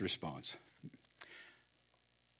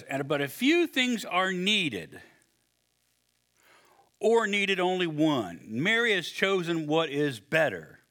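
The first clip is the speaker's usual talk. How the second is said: slow, measured; 3 semitones higher, slower than usual. Retold or imitated. Reading Jesus slowly.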